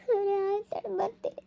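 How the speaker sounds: soft, high, teary okay; whisper-soft tearful surrender; crying wife gives in to husband; tearful giving in. Acted.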